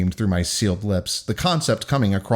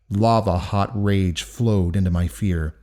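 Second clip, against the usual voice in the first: plain reading voice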